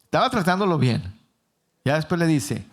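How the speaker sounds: ordinary delivery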